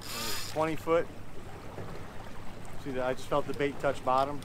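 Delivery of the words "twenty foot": ordinary talk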